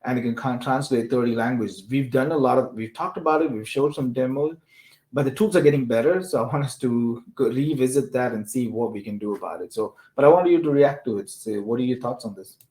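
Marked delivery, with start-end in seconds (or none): none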